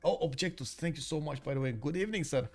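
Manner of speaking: ordinary talk, nothing marked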